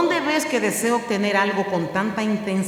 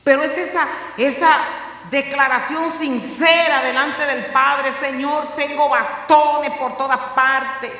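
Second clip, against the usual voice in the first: loud, high impassioned sermon voice; fervent preacher at full volume; full-force preaching; 7 semitones higher, 7 dB louder than usual. Acted. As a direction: preach it out, rising and pressing.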